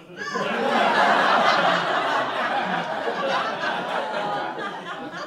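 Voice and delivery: sharp breathless gasp of delight; excited gasp. Acted. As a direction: gasp like a brilliant idea just hit. Excited realization on the train.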